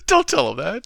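laughing, pleading don't tell him that; laughing plea. Real, not acted. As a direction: beg through the laughter. Repeat, laughing louder.

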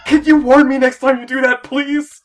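loud, high plea; exasperated plea. Real, not acted. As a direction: beg them never to do that again. Player scolds after scare.

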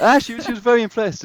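light up recalling the moment; real delight. Real, not acted. Recalling for the interviewer how impressed Princess Anne was, delighted.

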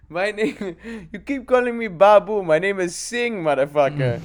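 indignant clerk correcting his name; mock Indian clerk. Clerk correcting his name, high put-on Indian accent. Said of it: "clerk quote".

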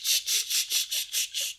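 whispered sh sh rustle of paper; newspaper page turning sound. Retold or imitated. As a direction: imitate a page flip with sh. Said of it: "I would do the same thing and be like".

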